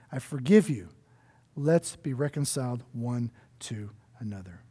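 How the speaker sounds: soft, low, gentle invitation; tender voice of God. Retold or imitated. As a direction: say it softly like a loving father welcoming back. God's words voiced, tender.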